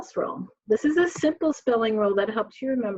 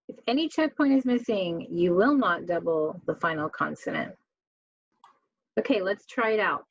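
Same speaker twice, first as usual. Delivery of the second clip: ordinary talk, nothing marked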